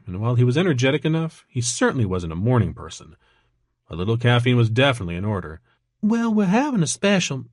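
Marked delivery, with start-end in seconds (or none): 6.0-7.5 perky high-pitched Georgia waitress; Georgia accent. A narrator's reading. Waitress greeting a breakfast customer, perky Georgia accent. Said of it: "an accent that was distinctly from Georgia".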